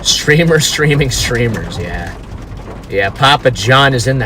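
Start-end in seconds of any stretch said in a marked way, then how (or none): none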